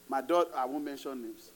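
normal talking voice

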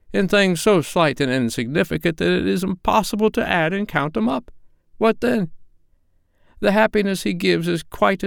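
put-on high, heated old man pleading; heated, impassioned. A narrator's reading. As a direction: defend the old master with rising passion. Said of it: "heated by the remark".

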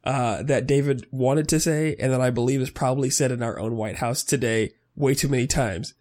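normal talking voice

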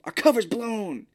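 a panicked parent who knows the cover's blown; mock argument. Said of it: "they're yelling".

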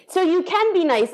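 high, pointed jab; teasing her gloomy partner, high and pointed. Retold or imitated. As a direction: needle him with a bright tone.

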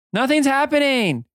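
put-on squeaky high girl voice; high Emmy voice. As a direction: pitch way up, puzzled. NPC Emmy, disappointed.

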